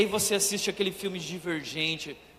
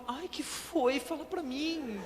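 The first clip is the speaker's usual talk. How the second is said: higher and softer, pleading and anxious; anxious girl; 6 semitones higher, 7 dB quieter, slower than usual. Retold or imitated. Frightened heroine begging the test nurse, high and soft. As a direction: beg nervously for the answer. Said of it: "ela toda insegura".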